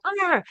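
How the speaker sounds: mock annoyed growl, high and sharp; a quick exasperated arr; irritated growl. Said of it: "my face would go".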